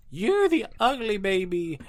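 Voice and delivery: put-on high squeaky kid taunting; loud high bratty child voice; childish taunt. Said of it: "They'd be like".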